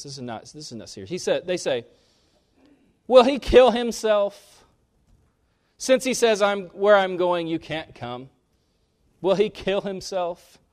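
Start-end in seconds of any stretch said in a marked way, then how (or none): 3.1-10.6 high, loud sneering mock. Pharisees mocking Jesus about suicide, high and sneering. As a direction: jeer at him like a scoffer. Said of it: "they're mocking Jesus when they say this".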